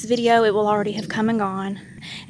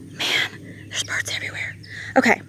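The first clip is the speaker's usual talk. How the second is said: whispering; 3 semitones higher, 5 dB quieter than usual. Real, not acted. Whispering near birds.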